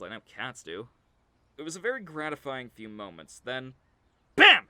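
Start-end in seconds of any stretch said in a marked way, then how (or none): 4.4-4.7 explosive high, loud exclamation; sudden shout. Acted. The dog suddenly goes for him, explosive shout. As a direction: burst out with a startling bang.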